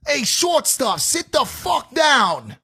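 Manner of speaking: high, drawn-out holler from behind; shouted heckle, pitched well up; heckler yelling. Retold or imitated. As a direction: yell it like a ringside heckler. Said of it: "someone's yelling at Ryan Garcia".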